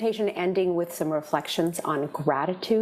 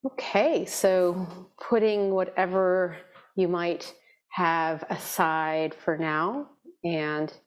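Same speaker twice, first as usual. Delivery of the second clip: guided meditation. Real, not acted. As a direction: ease them gently into stillness, leaving space between phrases.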